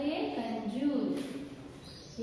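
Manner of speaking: ये कंजूस drawn out very slowly and softly; slow soft word. Shown as a demonstration. Teacher reading the finished word to the children, slow and soft. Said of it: "तो क्या होगा शब्द?".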